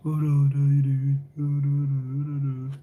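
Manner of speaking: very slow, slurred Brummie greeting; drugged-up Brummie. Retold or imitated. Streamer mocking a Brummie greeting to Steve, slow slurred drawl. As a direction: drag the Brummie hello out as if drugged up. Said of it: "I sound like I'm constantly, like, drugged up".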